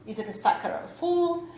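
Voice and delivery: ordinary talk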